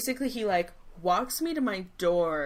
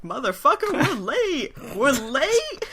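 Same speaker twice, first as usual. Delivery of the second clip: high, loud mock panic; frantic exclamation; 7 semitones higher, 5 dB louder than usual. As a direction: squeal it like a late traveller in a comic retelling. Retold kings panicking about lateness.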